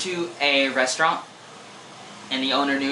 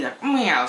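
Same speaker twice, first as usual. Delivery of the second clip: playful Catwoman meow; 7 semitones higher, 5 dB louder than usual. Pretend.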